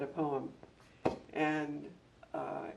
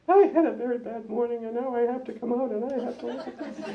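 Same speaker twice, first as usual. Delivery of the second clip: higher, louder mock wailing child, half laughing; imitating hysterical boy; 8 semitones higher, 9 dB louder, faster than usual. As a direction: retell the sobbing boy, laughing through it.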